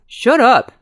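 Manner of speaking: loud, high yell. A narrator's reading. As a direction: snap it at your teasing friend. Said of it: "Jack yelled".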